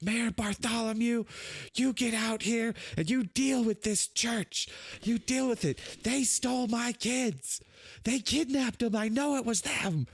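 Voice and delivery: high-pitched, strained mock scream; screaming townsperson. Angry parent screaming for the mayor about stolen kids, high-pitched yelling. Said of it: "they're screaming for the mayor".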